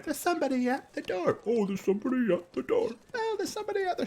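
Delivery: put-on high, fast child's voice, repeating eagerly; a giddy kid announcing a visitor; high, quick, breathless child chatter; excited child. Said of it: "you hear somebody going".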